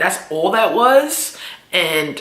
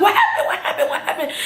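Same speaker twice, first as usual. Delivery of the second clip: panicked mother; 11 semitones higher than usual. Retold or imitated. Mother freaking out as her daughter comes home crying, frantic. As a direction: fret over your crying child.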